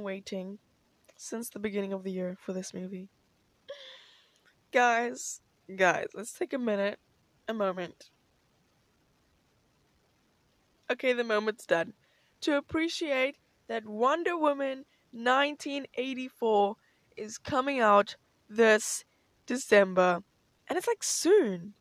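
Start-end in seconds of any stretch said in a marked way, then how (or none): 4.7-20.2 loud, gushing announcement; excited. Real, not acted. Gushing about the upcoming Wonder Woman. 20.7-21.7 high, slow gush; excited squeal. Real, not acted. Squealing about the release date.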